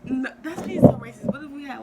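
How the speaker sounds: shouting over a laughing friend; loud outraged objection; loud protest. Real, not acted. Loud protest at racist joke.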